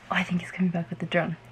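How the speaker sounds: quick hushed whisper. Real, not acted. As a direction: whisper fast so he won't hear. Hiding surprise, partner returning.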